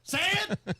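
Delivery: high, short mock yell; hammed-up hard-of-hearing old guy; old guy demanding. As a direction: imitate an old man ordering a repeat. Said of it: "Something an old guy would say".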